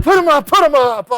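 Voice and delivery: high, loud barked order; urgent shout. Retold or imitated. Fire chief shouting orders to his crew at the blaze.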